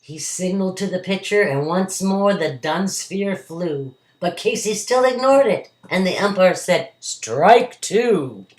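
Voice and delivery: lively storybook poem reading; reading to a toddler. A narrator's reading. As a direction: read the ballad to a child. Reads the poem aloud.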